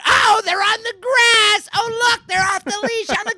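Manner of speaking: mock her shrill complaint back at her; hammed-up old woman screech; her own voice. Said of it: "yelling in her own voice".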